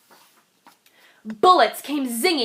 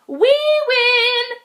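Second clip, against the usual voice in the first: much higher, louder, sing-song cheer; gleeful scream; 11 semitones higher, 6 dB louder than usual. Acted. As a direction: crow over the win. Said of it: "screamed the guests".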